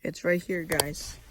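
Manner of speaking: usual speaking voice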